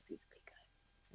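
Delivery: whispered suggestion, slow; mock whisper. Stylist whispers her hair suggestion to her. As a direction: breathe it out like a secret. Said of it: "And she goes".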